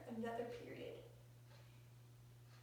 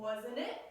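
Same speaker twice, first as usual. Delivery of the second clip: "wasn't it" with the pitch rising at the end; questioning rise. Shown as a demonstration. Teacher reading Beth's tag question, voice going up on "wasn't it". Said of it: "Your voice goes up".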